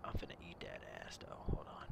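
fast breathy whisper; cupped hand whisper. Pretend. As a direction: whisper it into her ear. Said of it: "Let me whisper in your ear".

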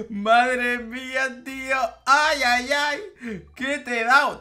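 high, loud gloating; euforia burlona. Acted. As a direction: crow over a winning insult. Celebra a gritos su insulto. (celebración burlona, aguda y a gritos)

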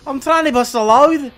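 high, loud, botched Scouse; Scouse accent attempt. Monster with a Scouse accent, badly faked. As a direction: try a Scouse monster voice and miss. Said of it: "Monster with a Scouse accent".

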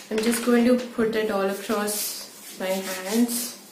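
through a sheet mask; speaking with a face mask on. Real, not acted. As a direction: face covered, lips uncovered.

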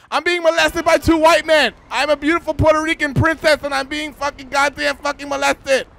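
high, loud mock-indignant shouting; loud outcry. Woman calling out to passers-by about the men, loudly. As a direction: bellow it to the whole street. Cries out for help.

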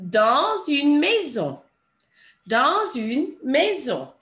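slow "dans une maison", the S of "dans" sounded into "une"; liaison on S. Shown as a demonstration. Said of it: "because the S runs into a vowel".

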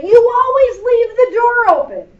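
high, loud mock scolding; mad parent. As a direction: imitate an exasperated parent yelling at a child. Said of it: "but when they're mad".

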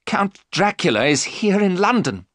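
high, agitated outcry; alarmed cry. A narrator's reading. Jonathan recognises Dracula in London, crying out. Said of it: "Jonathan cried".

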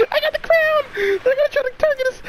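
high laughing triumph; excited. Real, not acted. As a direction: crow it out, thrilled. Holding crown, thrilled.

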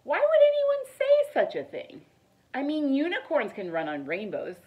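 ordinary delivery

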